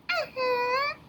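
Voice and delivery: high, slow, squeaky puppet greeting; a cheerful little puppet says hello; high cartoon puppet voice calling out; puppet Leo voice.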